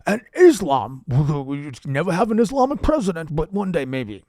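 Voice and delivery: loud senator impression, easing off at the end; Bernie Sanders. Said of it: "Careful, Bernie".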